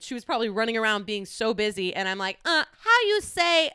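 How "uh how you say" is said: high, loud mock fumbling for words; hammed-up halting tourist, pitched way up; bumbling language learner. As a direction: imitate yourself struggling in a foreign language. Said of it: "I'm, like, being an idiot".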